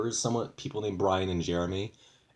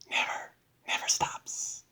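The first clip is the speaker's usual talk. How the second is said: breathy whispered repeat. Real, not acted. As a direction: whisper it to yourself, drained. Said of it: "unvoiced far whisper".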